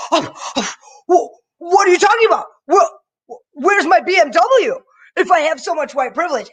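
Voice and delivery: high, loud, whiny mock outrage; hammed-up high protest about privilege.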